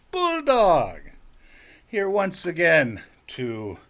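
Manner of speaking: mock-grand podcast opener; grand host intro. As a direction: announce yourself like a hammed-up showman. Show host introducing himself as Bulldog.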